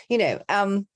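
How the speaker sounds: normal talking voice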